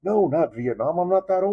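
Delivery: high, offended protest; worked-up, high-pitched objection; retold indignation. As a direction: re-enact an exasperated correction. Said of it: "I got so mad".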